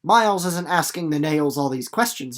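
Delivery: normal talking voice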